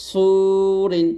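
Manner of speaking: সুরিন very slow with a thick সু; slow heavy su. Shown as a demonstration. Teacher repeating সুরিন slowly for students. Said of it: "সদ ওয়াও পেশ সুরিন এরকমভাবে".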